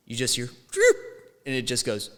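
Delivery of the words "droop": short, high vocal sound effect; sudden high-pitched mimicked noise; imitated building noise. Dreamer mimicking the building starting to give way, imitated noise.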